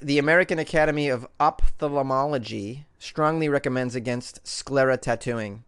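normal talking voice

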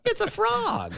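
mock-delighted high-pitched guess; playful squeal. Guessing at a connect-the-dots picture, playful high squeal.

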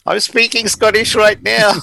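hammed-up Scottish accent, high and loud; mock Scottish accent. Jokingly imitating David Tennant to a podcast co-host, mock Scottish accent. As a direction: ham up a thick Scottish accent to make your mate laugh. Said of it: "David Tennant did Doctor Who and had to do a non-Scottish accent".

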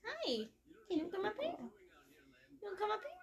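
high sing-song coaxing. Real, not acted. Coaxing the cat up.

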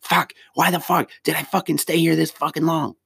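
high, strained angry venting; angry outburst. Retold or imitated. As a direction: blurt it out, fed up and furious. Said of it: "me being angry and just being like".

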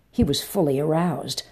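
usual speaking voice

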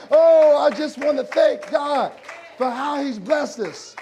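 happy rising shouted praise; joyful preaching. Acted.

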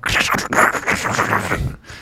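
harsh crackling static noise made by mouth; imitated distorted audio. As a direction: crackle like an overdriven speaker. Imitates audio that is too loud.

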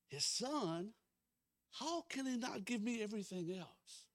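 high, ringing preacher delivery; impassioned preaching. Real, not acted. Pastor proclaiming God gave his son, impassioned preaching. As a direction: preach the verse with mounting fervor, stretching the key words. Preaches scripture at full force.

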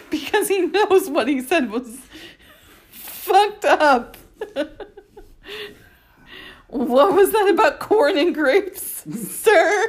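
high, laughing teasing. Real, not acted. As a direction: tease him while cracking up.

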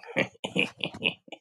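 short mocking witch cackle; witch's laugh. The witch laughs at Dorothy, cackling. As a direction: cackle at the child, voiceless and quick.

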